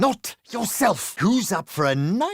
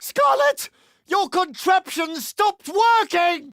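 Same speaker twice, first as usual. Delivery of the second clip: high shouted call across the battlefield; 7 semitones higher, slower than usual. Acted. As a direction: yell her name to get her attention. Calling out to ally in battle.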